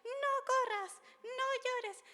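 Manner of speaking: high, slow mock scold of a child; hammed-up nagging parent voice. Imitating a parent telling a child off. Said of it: "Y decimos".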